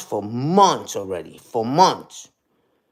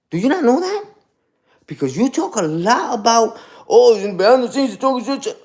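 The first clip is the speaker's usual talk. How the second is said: mocking the haters; 5 semitones higher than usual. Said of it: "They be talking about".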